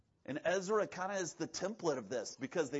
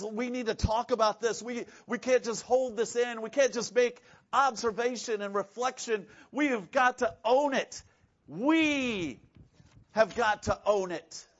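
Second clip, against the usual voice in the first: mock urgent, louder and higher; urgent plea; 5 semitones higher, 6 dB louder than usual. Ezra urging his people to own their sin, loud and insistent. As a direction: press the point hard as Ezra.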